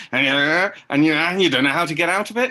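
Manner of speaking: an actor trapped in an escalating rant; mounting panic. Retold or imitated. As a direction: rush on, losing control of the scene. Escalating angry performance.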